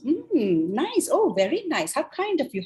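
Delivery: smooth, swooping approval; suave wolf. Acted. Wolf hears the girl's plans for grandma, suavely approving. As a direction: lay on the suave flattery with gliding pitch. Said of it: "in his very suave, uh, uh, way".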